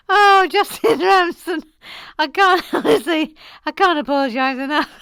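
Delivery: high, loud, helpless apology; laughing apology. Real, not acted. Host apologising to a viewer, laughing helplessly. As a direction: apologise through real laughter.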